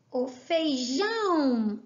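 "feijão" slow, JÃO stretched long; stress on JÃO. Shown as a demonstration. Teacher showing the tonic syllable, JÃO held long in "feijão". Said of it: "é o jão".

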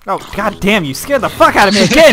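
loud startled yelling; startled angry yell. Real, not acted. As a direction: yell after a jump scare. Scared by Tyler in game.

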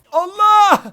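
high, loud startled cry; panicked yell. Real, not acted. Real panicked yell caught in a gunfight. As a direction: yelp as bullets hit.

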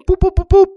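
high, loud electronic boops; imitated key beeps. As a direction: mimic a row of fob beeps.

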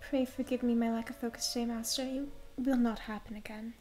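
lightly voiced meek, grateful servant; a hint of a humble, devoted girl in service. Plays devoted servant Primrose.

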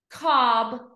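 COB joined in one go; blended word. Shown as a demonstration. Teacher blending for pupils, COB as one word. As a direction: say COB whole. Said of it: "read each word fast".

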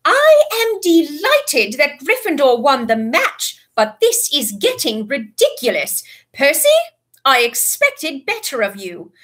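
slow, loud, cold scolding; stern teacher. A narrator's reading. McGonagall scolds the house.

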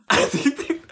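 high laugh into the words. Real, not acted. Reading a viewer comment, laughing. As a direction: giggle as you start.

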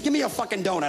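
ordinary talk

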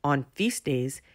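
ordinary delivery, nothing marked

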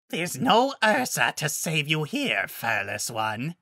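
put-on monster voice, slowing at the end; menacing beast, high then sinking; taunting werewolf alpha. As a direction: taunt the prey, drawing out the last words.

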